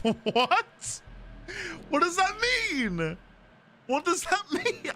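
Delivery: high, helpless laughing question; baffled and giggling; real laughing disbelief. Real, not acted. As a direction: crack up while asking, pitch soaring. Cracks up at own Scots attempt.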